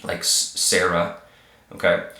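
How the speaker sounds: normal talking voice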